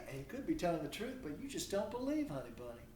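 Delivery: usual speaking voice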